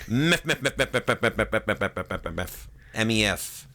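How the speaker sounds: rapid monotone repeats, fading out; computer imitation. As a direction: chant it like a stuck computer.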